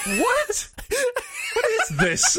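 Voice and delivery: very high, helpless laughing question; laughing disbelief. Real, not acted. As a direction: squeal it out in baffled laughter.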